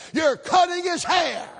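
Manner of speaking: loud, high triumphant call; bold reveal. Retold or imitated. Preacher telling the hairdresser she's cutting his hair, shouted. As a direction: announce it proudly.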